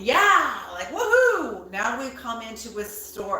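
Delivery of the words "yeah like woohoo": loud, high-pitched woohoo; excited cheer. Acted. Tarot reader acting out flared-up energy, excited cheer. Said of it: "excited and flared up energy here".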